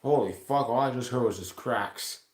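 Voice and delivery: ordinary delivery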